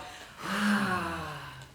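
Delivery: long soft falling sigh; audible sigh. Shown as a demonstration. Leading the group, soft sigh. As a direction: let the breath go out. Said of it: "Now sigh out".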